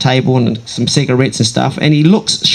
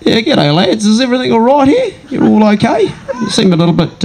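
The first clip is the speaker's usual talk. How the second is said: higher-pitched Kiwi greeting, feigning innocence; breezy Kiwi accent, pretending to know nothing; 6 semitones higher than usual. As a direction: play the innocent Kiwi checking on the lads. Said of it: "in my Kiwi accent".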